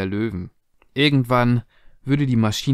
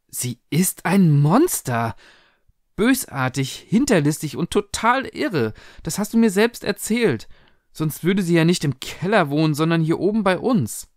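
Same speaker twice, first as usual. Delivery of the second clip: high, heated outburst; agitated girl arguing back; outraged daughter; 6 semitones higher than usual. Acted. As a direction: hurl accusations at your mother, pitched up.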